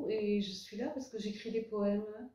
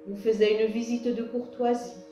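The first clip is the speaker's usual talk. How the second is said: louder, projected poem recitation with measured pauses; poem reading; 5 dB louder than usual. Acted. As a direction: read your poem aloud, line by line, lifting the refrains. Reads own poem to audience. (lecture de poème à voix haute, posée et projetée)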